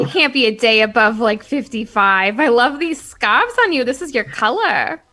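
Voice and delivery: fawning flattery. Acted. Flattering the pirate queen.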